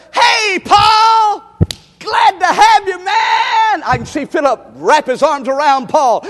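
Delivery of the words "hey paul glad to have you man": loud, high, joyful welcome; hearty greeting. Acted. Philip greeting Paul at the door, joyful loud welcome. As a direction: throw your arms open and call out.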